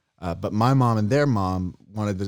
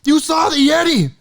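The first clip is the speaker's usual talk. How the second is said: high, loud, breathless-with-excitement uncle; building to a high shouted exclamation; mock frantic relief; 14 semitones higher, 9 dB louder than usual. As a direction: imitate a man crying out in feigned fright.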